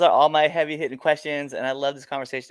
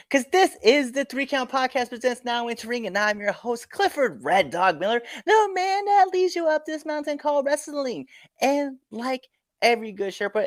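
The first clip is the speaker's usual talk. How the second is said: announcer; 7 semitones higher than usual. Acted. Host's show outro presentation.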